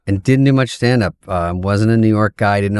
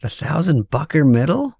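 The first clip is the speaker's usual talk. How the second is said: stunned disbelief; 4 semitones higher, slower than usual. Retold or imitated. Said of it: "I'm like".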